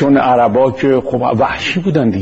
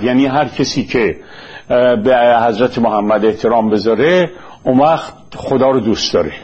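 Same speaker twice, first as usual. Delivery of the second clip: normal talking voice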